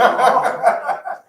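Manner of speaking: loud, high burst of laughing; real laughter. Real, not acted. Group hearing the pop story, real laughter.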